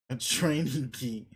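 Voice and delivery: slow, disbelieving repeat; incredulous. Acted. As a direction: echo it back in disbelief. Repeating his absurd claim back.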